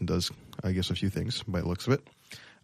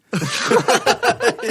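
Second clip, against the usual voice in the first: sudden squealing laugh; high burst of laughter; 7 dB louder than usual. Real, not acted. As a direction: laugh out loud, high and bright. Laughs at the asshole remark.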